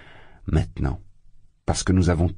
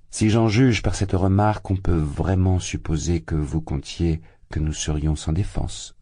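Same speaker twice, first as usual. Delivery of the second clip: usual speaking voice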